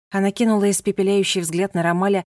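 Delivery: ordinary delivery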